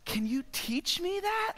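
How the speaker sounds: high, slow, hushed wonder; small, high, amazed voice; awestruck. Acted. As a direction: ask softly in starstruck awe. Awestruck at his rescuer.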